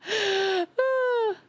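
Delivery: high delighted laugh; burst of laughter. Real, not acted. Streamer cracking up at chat, high laugh.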